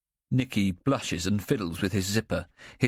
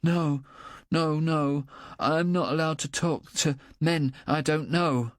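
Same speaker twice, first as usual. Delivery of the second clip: put-on panicky child, near tears; 8 semitones higher than usual. A narrator's reading. Boy refusing to talk to strangers, high and near tears. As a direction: push back frightened. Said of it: "about to burst into tears".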